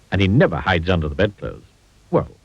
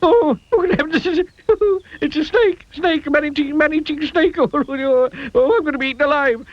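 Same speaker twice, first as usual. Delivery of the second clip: panicky squeak. A narrator's reading. Mister Jelly panics at worm.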